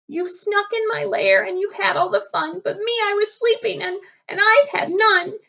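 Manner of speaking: a bear whining about being left out; whiny bear. Acted.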